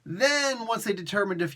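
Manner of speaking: ordinary talk, nothing marked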